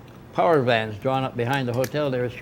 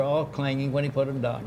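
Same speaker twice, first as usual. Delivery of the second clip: ordinary talk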